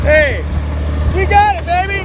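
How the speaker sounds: high, loud triumphant shout; excited cheer. Real, not acted. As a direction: celebrate the win. Celebrating the job.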